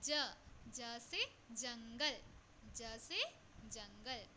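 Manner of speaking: very slow, drawn-out letter chant; slow classroom recitation; slow drill. Shown as a demonstration. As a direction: sound out each word slowly for children. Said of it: "slow letter drill".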